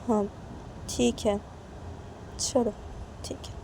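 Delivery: soft, small-voiced reply; meek agreement. Reshma agrees to go down for breakfast, meek. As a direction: give in quietly, in short pieces.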